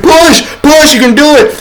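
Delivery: loud, high urging shouts; coaching push. Retold or imitated. Helper coaching the girl in labor, loud urging. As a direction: urge her on like a birth coach. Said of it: "he's like".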